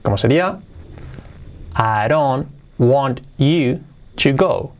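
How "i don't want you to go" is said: slow, word-by-word with gaps; careful teaching take; slow model. Shown as a demonstration. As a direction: dictate each word separately. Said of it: "Y con entonación".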